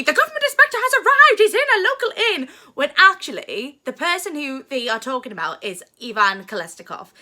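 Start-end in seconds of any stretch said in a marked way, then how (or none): 0.0-2.5 high, loud, rapid-fire cry; frantic clown announcement. Acted. Two clowns announcing the inspector's arrival, frantic and excited. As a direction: burst out with urgent news in a comic squeal.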